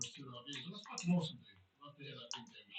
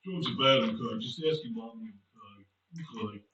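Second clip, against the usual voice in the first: very low droning counsellor; Mister Mackey impression; 12 semitones lower than usual. Counsellor warning children about drugs, low Mister Mackey voice. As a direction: drone it down low, preachy. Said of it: "my name's Mister Mackey".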